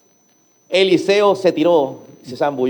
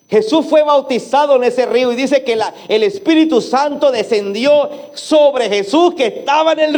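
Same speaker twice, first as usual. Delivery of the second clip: fervent preaching; 5 semitones higher than usual. Acted. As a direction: preach it with rising passion. Preaching at rising force. (predicación fervorosa y creciente)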